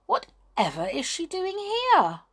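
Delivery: high-pitched, incredulous question; wide-eyed wonder, voice leaping up; astonished. A narrator's reading. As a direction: ask it in utter amazement. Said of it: "in the greatest astonishment".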